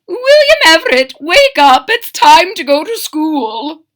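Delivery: very high, loud wake-up call; Mama waking son. Acted. Mama wakes her son for his first school day, high loud call. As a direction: sing out to rouse a sleepy child.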